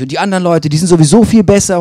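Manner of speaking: hammed-up outburst of envy; rising self-pity. Retold inner voice, self-doubt. (laut gespielte Selbstabwertung)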